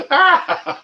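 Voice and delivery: a sudden high giggle bursting out; squealing laugh. Real, not acted. Laughing at a funny chat name, high squeal.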